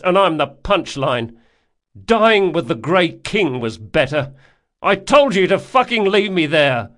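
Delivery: high, loud bitter tirade; furious shouting. A narrator's reading. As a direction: rail loudly at the one who rescued you. Furious outburst at his rescuer.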